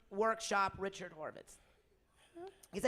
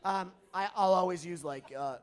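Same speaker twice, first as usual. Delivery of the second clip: normal talking voice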